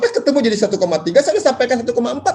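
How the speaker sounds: hammed-up disbelief at the lowball; exasperated agent. Retelling haggling with a buyer.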